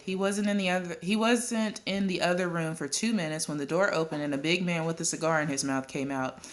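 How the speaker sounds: plain reading voice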